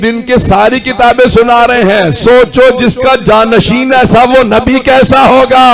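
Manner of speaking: loud, drawn-out oratory; impassioned preaching. Real, not acted. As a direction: proclaim it slowly to the crowd.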